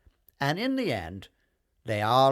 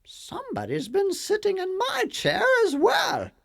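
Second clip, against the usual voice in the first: much higher mama bear; pitched-up fussing mother bear; 5 dB louder than usual. A narrator's reading. Mama Bear notices her chair was used, high mama voice. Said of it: "said Mama Bear".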